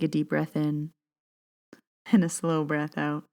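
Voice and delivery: unhurried, soothing reading voice; guided meditation. Acted. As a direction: guide listeners gently into their breath.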